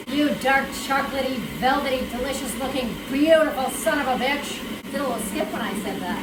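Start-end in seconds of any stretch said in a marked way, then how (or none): 0.1-4.6 loud, emphatic gushing over a machine; mock-fierce praise. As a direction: shout lustful praise at the food. Praising the paste over the processor.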